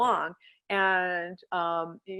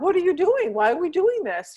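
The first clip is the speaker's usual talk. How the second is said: voiced dog protest; 9 semitones higher, 4 dB louder than usual. Dog's confused protest voiced.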